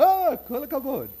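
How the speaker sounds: high, slow, loud admiring Israeli exclamation; teacher praising the name, delighted. Retold or imitated. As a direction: gush "kol hakavod" like a delighted Israeli teacher. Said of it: "The Israeli teachers go".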